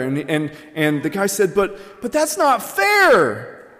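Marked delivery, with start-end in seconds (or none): 1.5-3.7 high, drawn-out mock whine; whiny protest. Student protests to the professor about the zero, whining. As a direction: stretch it into an indignant student's complaint.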